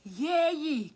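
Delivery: high, very loud drawn-out exclamation; mock cheer. Reacting to his apology paragraph, loud high mock cheer. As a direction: belt out a long yay.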